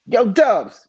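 high, loud greeting; calling out. Retold or imitated. J Lo calling to her DJ, high and loud. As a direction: call his name across the stage.

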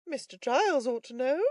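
high, polite character voice; third man. A narrator's reading. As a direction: venture it politely to the gentlemen. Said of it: "said the third".

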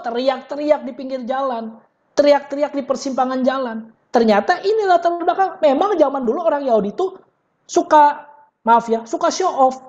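usual speaking voice

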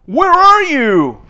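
high, loud and slow call. Shown as a demonstration. Teacher acting out a phone question for the class. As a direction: call out as if across a street. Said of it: "dialogue example".

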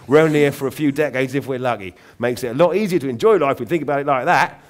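put-on a ghoulish pirate-king raven lecturing a boy; gleeful London raven. Raven cheerfully preaching death to Aubrey, loud Cockney croak. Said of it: "loud, throaty voice and an accent".

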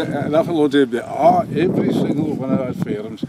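usual speaking voice

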